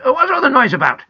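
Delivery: loud, blustering grandfather; shouting. A narrator's reading. Grandpa running downstairs to the noise, shouting. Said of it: "he shouted".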